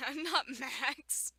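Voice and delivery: laughing. Real, not acted. Reader laughing at their own misreading, words giggled out. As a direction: laugh through the correction.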